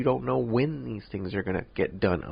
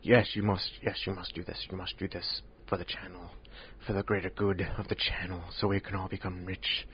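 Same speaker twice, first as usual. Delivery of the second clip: mock coercion; 9 dB quieter than usual. Mimicking pressure on a friend for the channel, mock hushed command. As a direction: lean in softly, pretending to pressure him.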